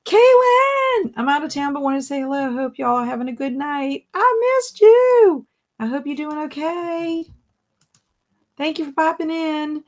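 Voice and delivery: high, loud, thrilled hello; delighted greeting. Real, not acted. Host greeting a viewer she missed, high and delighted.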